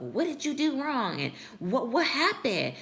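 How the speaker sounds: high-pitched imitation of baffled self-questioning; mock anguished questioning. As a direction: act out the hurt ego pleading for answers. Voices ego questioning itself after ghosting.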